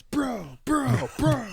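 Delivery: high bro yelps; frat bro. Acted. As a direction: yelp each one like a falling bro. Frat boys run over by cars.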